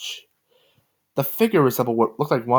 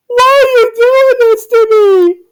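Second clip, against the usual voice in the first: much higher, much louder, slow wailing cry; really sad; 13 dB louder, slower than usual. A narrator's reading. Joe wailing at her attacker, crying hard. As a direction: sob it out in agony. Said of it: "Joe asked in a really sad voice".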